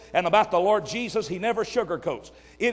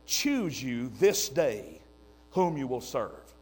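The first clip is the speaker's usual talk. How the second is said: solemn scripture reading; 8 semitones lower, 6 dB quieter, slower than usual. Retold or imitated.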